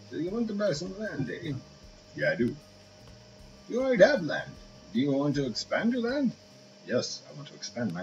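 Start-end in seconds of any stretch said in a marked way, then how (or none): 0.1-1.5 high, nasal-free merchant character voice, much higher; shopkeeper voice. Acted. Gamer voicing the shopkeeper, pitched way up. 3.7-6.3 high, nasal-free merchant character voice, much higher; shopkeeper voice. Acted. Gamer voicing the shopkeeper, pitched way up.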